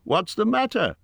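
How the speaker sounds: high-pitched call into the dark. A narrator's reading. Bilbo to the wailing Gollum, calling out. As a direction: call over to someone some way off. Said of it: "Bilbo called".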